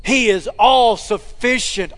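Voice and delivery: ordinary talk, nothing marked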